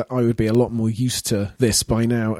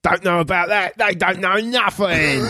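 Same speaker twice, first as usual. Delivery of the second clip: lightly put-on Cockney taxi driver; a hint of a Cockney cabbie, slightly higher; mock Cockney; 4 semitones higher than usual. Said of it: "a line we could have plugged in".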